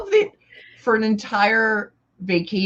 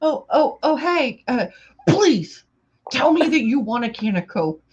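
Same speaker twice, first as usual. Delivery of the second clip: higher-pitched, put-on pleading; mock polite pleading; 6 semitones higher than usual. Friend re-enacts asking about a Coke, mock pleading. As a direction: plead in a raised, play-acted voice. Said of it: "raging and then every now and then".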